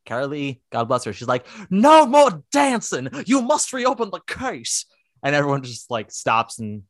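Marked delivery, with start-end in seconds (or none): 1.7-4.9 high, loud, slow mock shout. Podcaster imitating Lucille shouting at the party. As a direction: command the room to stop dead. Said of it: "literally shouts".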